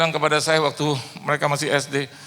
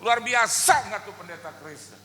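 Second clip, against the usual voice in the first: higher, mock hollering to the crowd; calling out; 10 semitones higher than usual. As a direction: busker calls to the people around, hollering. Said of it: "dia teriak sama yang di sekeliling".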